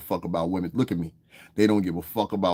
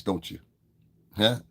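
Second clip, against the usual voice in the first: normal talking voice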